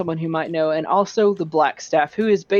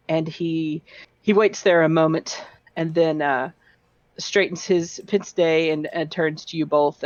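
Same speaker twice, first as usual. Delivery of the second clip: ordinary delivery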